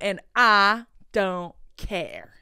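very slow, loud "don't care"; exaggerated indifference. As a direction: stretch every word to show total disinterest. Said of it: "That's how I felt".

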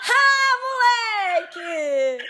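high, stretched-out cry of joy; thrilled cry. Real, not acted. YouTuber hearing a screaming fan answer her call, high drawn-out cry. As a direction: let it out slowly like pure excitement.

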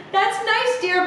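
high, absent-minded "that's nice, dear"; distracted mom. A narrator's reading. Busy mom answering Federica without looking, high and absent. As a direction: brush her off sweetly, not really listening. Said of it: "Mom answered without looking up".

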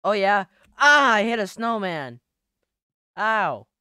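normal talking voice

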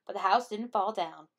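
usual speaking voice

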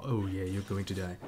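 usual speaking voice

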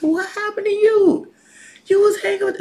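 high, loud accusing question; mock-astonished crowd voice; acted incredulous challenge. Crowd confronting the denying disciple.

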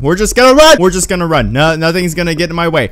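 high, loud, frantic repetition; panicked babble. Real, not acted. Player just running from the creature, panicked loud babble. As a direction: keep shouting to push through the panic.

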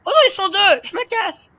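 high, loud fleeing panic; panicked mock voice. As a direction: squeal it like a scared player. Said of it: "Et le mec, c'est". (voix paniquée imitée, aiguë et forte)

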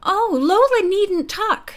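slow, lifted, kindly voice; friendly. A narrator's reading. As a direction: reassure her in an easy, friendly way. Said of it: "said in a friendly voice".

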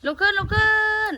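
high, loud command to rise; calling out. Real, not acted. Calling elephant to get up. (ร้องเรียกเสียงดังและสูง)

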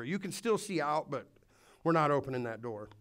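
ordinary delivery

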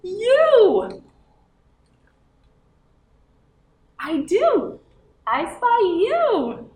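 high, loud puppet cow. Acted. Cow puppet asks the children what they spy. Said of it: "says cow".

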